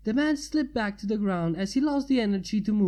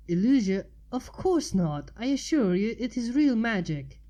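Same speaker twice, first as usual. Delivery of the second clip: mildly indignant correction; offended; 4 semitones higher than usual. Acted. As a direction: set him straight, a bit affronted. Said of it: "as if she was offended".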